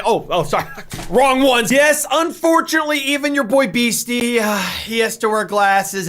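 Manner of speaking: ordinary talk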